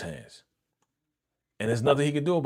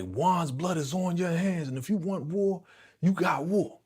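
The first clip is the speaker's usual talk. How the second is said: raised-pitch, dramatic declaration of war; heated retold warning; acted threat; 7 semitones higher than usual. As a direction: imitate an angry man laying down an ultimatum.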